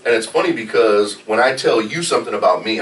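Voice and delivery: normal talking voice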